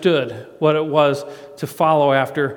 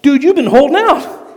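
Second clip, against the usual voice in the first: amazed accusation; 11 semitones higher, 8 dB louder than usual. Retold or imitated. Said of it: "I'm like".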